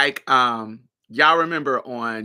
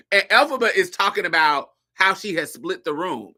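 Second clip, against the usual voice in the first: ordinary talk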